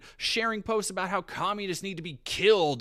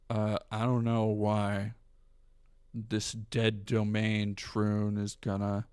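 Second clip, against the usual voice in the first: impression of Synth; 7 semitones lower than usual. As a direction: drag every word out lazily. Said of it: "let me do a better".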